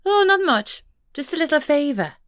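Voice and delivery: high, coy pixie voice; coquettish pixie. A narrator's reading. Pixie to the detective, coquettish and high. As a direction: flirt for the favour. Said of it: "She smiled coquettishly".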